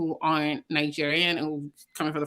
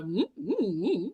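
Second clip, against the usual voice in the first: imitating wordless humming answers; mute-act humming; 5 dB quieter, slower than usual. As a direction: hum as if unable to speak. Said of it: "started acting like he couldn't speak".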